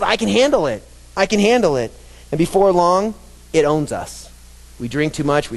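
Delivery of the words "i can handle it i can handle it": higher, breezy mock confidence; imitating a cocky churchgoer; mock self-assurance. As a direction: voice the careless boast. Mocking self-deceiving boast.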